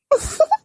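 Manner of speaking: short high giggle; a sly little laugh; cheeky giggle rising up. Acted. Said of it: "എന്താ ചിരിക്കുന്നേ?".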